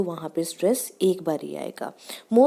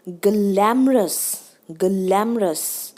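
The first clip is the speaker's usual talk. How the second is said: slow glamorous, front stressed, tail dropping low; high start, low end; much slower than usual. Shown as a demonstration. Teacher showing the voice dropping, slow glamorous falling at the end. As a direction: stretch the start of glamorous and let the end fall. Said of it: "पीछे जाके हमारी voice है जो वो लो हो जाती है".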